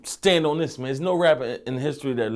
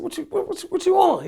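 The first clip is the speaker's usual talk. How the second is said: much higher, eager question; imitating his own eager asking; mock excited what you want; retold eager asking; 3 dB louder than usual. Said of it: "I said".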